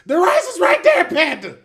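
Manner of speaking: very high, full-blast shriek imitating a furious cook; exasperated yelling. Head cook yelling at Panda over the rice, exasperated scream. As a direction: scream it at a clueless teammate.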